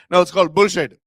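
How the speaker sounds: put-on loud Indian-accented BULLSHIT. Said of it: "that certain Indian accent".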